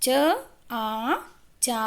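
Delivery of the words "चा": usual speaking voice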